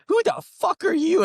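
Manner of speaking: shot way up high and loud, imitating the boyfriend; mock furious yell. Boyfriend turning on the speaker, high mock furious yell. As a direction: scream it up like the jealous boyfriend.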